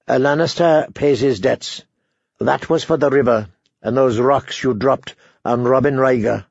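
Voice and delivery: ordinary delivery, nothing marked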